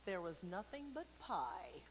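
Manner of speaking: ordinary delivery, nothing marked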